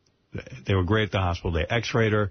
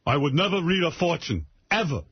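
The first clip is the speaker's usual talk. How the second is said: stern father; 8 semitones higher than usual. Retold or imitated. Daughter imitating her upset father, higher and scolding. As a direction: scold like a fussy old father. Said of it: "father quoted again".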